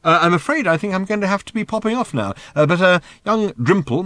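high, hesitant public politeness; aloud, awkwardly formal. A narrator's reading. Arthur excusing himself to venerable Thrashbarg, awkwardly polite and hesitant. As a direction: make halting, high-pitched excuses. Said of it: "Aloud, he said".

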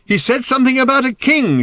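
high, loud strained reply; high-pitched desperate shout; shouting back. A narrator's reading. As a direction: yell back over the din. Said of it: "shouted Arthur in return".

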